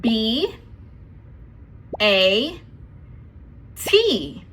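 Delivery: letter names, one at a time; spelling out letters. Shown as a demonstration. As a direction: say each letter name separately. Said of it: "spell the word by saying each letter".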